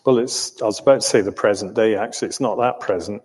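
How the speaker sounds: fast correction; rushed aside. Real, not acted. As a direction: hurry through the aside. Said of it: "self-correction rushed".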